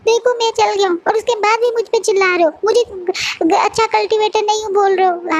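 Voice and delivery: high tearful whine, words breaking; a wounded boy crying while he complains. Acted. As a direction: sob it out like a hurt child. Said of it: "रोते बोलता है".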